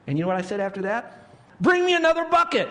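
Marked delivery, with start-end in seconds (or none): 1.6-2.7 high, loud excited demand; eager shout. Retold or imitated. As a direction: holler for more as a punchline. Retold eager call for more.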